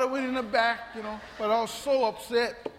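ordinary talk

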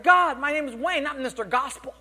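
high, loud, hammed-up whine to God; indignant complaint. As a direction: protest upward like someone deeply affronted. Retold complaint to God in car.

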